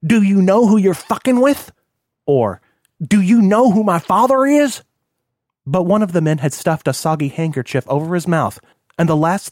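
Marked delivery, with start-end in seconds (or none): 0.0-1.7 higher, louder entitled outburst; indignant scream. A narrator's reading. Spoiled son yelling at his kidnappers, indignant scream. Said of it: "tried to scream something". 3.0-4.8 put-on high, loud rich-boy outrage; indignant scream. A narrator's reading. Spoiled son threatening his kidnappers, indignant scream. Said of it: "tried to scream something".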